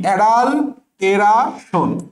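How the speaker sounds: অ্যাডাল্টেরাশন slowly split as adal, te-ra, shon; spelling pronunciation. Shown as a demonstration. Teacher demonstrating for students, slow spelling pronunciation. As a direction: stretch অ্যাডাল্টেরাশন part by part. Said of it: "কি উচ্চারণ হবে?".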